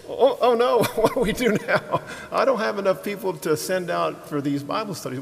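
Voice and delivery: high, loud comic "oh no" with a laugh; mock panic. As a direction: play up being overwhelmed, easing down gradually. Acts out his own dismayed reaction.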